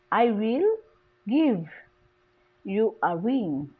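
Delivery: "give" set apart by pauses, "ring" falling; content word stress. Shown as a demonstration. Teacher isolating stressed content words, stress on "give". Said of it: "the stress is give".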